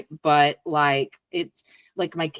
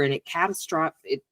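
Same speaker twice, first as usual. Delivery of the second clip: usual speaking voice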